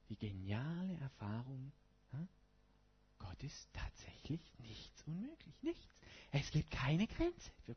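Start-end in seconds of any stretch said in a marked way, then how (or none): 3.2-5.9 awed whisper. Acted. Preacher to congregation, awed whisper. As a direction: whisper it, full of wonder.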